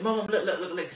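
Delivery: put-on a kid tugging for attention; eager child. Child calling mum to see the drawing, eager and high. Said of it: "and I said".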